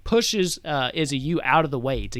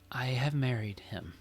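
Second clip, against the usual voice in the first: soft, breath-held admission; quiet confession; 7 dB quieter than usual. Acted. Daughter confesses her marriage to the king, quiet. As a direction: say it softly and deliberately.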